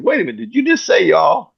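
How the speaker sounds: high-pitched, incredulous retold question; mock incredulous. Speaker retells challenging the kid over y'all, mock incredulous. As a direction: catch him out, pitch jumping way up.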